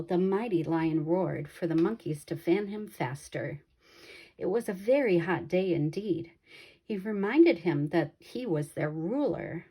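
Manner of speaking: ordinary delivery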